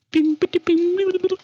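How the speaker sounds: high squeaky guitar plinks; twangy guitar imitation. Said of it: "I can't do it".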